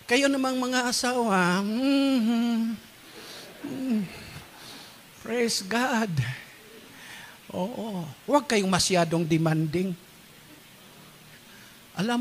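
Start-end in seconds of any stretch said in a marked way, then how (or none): none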